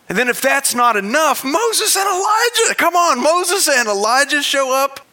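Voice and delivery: high, loud exclamation; excited. Real, not acted. Preacher marvels at Moses and Elijah, high and loud. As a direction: burst out in amazement.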